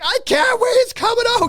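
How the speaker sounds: put-on very high, loud little-kid panic; panicked kid voice. Son warning dad in restroom, panicked little voice. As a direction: cry it out like a toddler who can't hold it.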